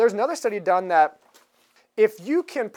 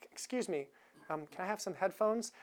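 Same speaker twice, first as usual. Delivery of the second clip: much softer, meek polite ask; timid request; 13 dB quieter than usual. Retold or imitated. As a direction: shrink away as you ask for headphones. Timid passenger voiced in retelling.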